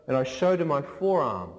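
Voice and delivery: ordinary talk, nothing marked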